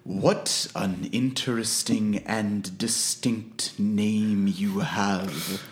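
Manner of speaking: very slow, menacing, drawn-out villain voice; booming arrogant alien overlord. Acted. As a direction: savour each word like a gloating overlord. Player voices vengeful character.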